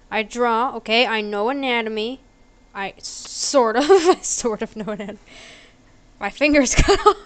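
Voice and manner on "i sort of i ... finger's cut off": breaking into laughter mid-sentence; cracking up while speaking; laughing. Real, not acted. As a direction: talk through a laugh, getting louder. Cracking up at own joke.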